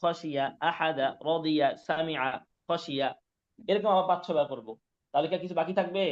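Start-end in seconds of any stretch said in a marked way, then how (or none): 0.0-3.1 whole line read slowly and evenly; teacher models reading the full line. Shown as a demonstration. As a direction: read আহাদা, রদিয়া, সামিআ, খসিয়া in a slow steady flow. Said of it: "এবার পুরো লাইনটা এভাবে পড়বো".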